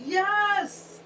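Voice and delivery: squeaky eager yes; bright emphatic agreement; excited high yes. Real, not acted. Answering enthusiastically.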